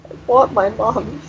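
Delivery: put-on sobbing child.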